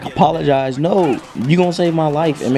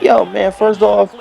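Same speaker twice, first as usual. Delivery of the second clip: irritated comeback; 7 semitones higher than usual. Retold or imitated. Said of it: "I'm like".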